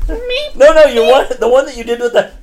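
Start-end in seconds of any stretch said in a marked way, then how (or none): 0.1-0.6 high squeaky meep chirp; chirping cricket voice. Retold or imitated. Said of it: "That sounds like a cricket".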